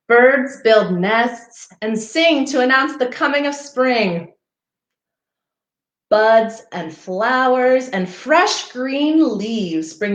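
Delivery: gentle storybook read-aloud; reading to children. Acted. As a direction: read it like a picture book for little ones. Reading picture book to children.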